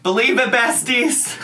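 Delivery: out of breath and panting; breathless after a short workout. Real, not acted. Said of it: "I'm a little out of breath".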